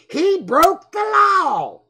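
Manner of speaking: loud, high, slow proclamation; mock outrage. Real, not acted. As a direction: cry out the verdict, drawn out. Mock outrage at the thought crime.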